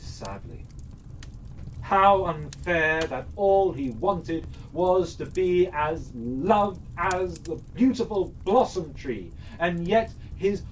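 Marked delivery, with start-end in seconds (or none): none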